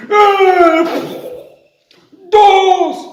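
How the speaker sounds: grito agudo y muy fuerte; grito furioso. Acted. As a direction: cuenta a gritos. Monstruo cuenta gritando. (monstruo grita la cuenta)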